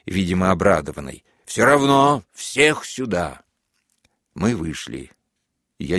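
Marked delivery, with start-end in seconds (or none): 1.5-3.4 high, slow insistent yell; shouted command. A narrator's reading. Major insists everyone come out, shouting high. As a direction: hammer the order home.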